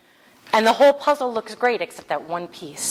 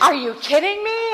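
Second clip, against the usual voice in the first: much higher, louder, laughing in disbelief; 13 semitones higher, 6 dB louder than usual. Real, not acted. Laughing disbelief as she opens the story. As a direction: burst out laughing in amazement.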